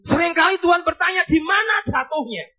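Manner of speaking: loud, high preacher's cry; full-force preaching. Acted. Preacher telling the church of the pastor's burden, fervent and loud. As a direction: preach it out to the congregation.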